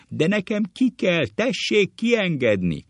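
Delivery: very high, loud schoolboy plea; hammed-up pupil's shout; yelling. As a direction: squeal urgently to be let out. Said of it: "Gümőke ordít".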